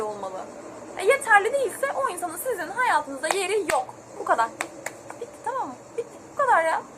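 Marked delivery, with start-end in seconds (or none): none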